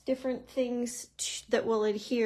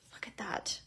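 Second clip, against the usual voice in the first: whispering; 10 dB quieter than usual. Real, not acted. Admiring finished embossed panel.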